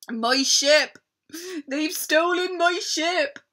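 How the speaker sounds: loud, high distraught wail; sobbing. Acted. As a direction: wail about the lost ship. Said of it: "he sobbed".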